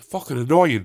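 ordinary talk, nothing marked

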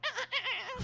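high squeaky raccoon noises through laughter; raccoon chatter. Retold or imitated. Raccoons in the room chattering, high, laughing squeaks. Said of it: "they, they, they were all like".

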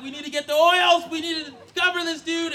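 high, urgent panic; rally help in a fluster; freaking out. Acted. Parents panicking over a possessed son, freaking out.

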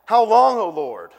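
loud cry, high on long; retold shout. Man at staff prayer shouting his lament. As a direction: cry out to heaven. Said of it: "one guy just shouts".

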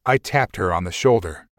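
ordinary delivery

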